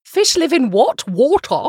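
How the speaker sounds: high, put-on British accent on a question; mock British accent. Mimics British pronunciation of water.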